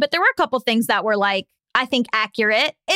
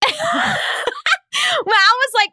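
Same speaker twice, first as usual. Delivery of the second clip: laughing; much slower than usual. Real, not acted. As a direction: laugh through the words. Breaks up laughing mid-line.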